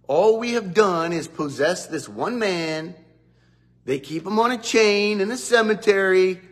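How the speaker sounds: the demons begging, panicked and shrill with fear; frantic demon voice. Acted.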